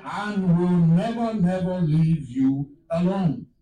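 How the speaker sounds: fervent preaching quote. Acted. Quoting God's promise, preaching.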